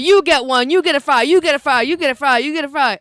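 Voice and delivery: loud, excited host handing out fries; gameshow giveaway. Pretend. Hyped host, giving fries to the audience.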